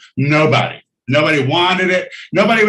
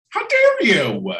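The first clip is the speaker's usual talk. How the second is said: mock outrage; 9 semitones higher, slower than usual.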